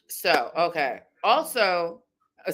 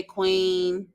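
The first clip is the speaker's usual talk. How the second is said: ordinary delivery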